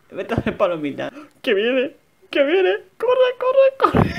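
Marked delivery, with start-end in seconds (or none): 1.4-4.2 high frantic shouting; being chased. Real, not acted. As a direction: scream to run away.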